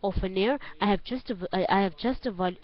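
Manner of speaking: ordinary talk, nothing marked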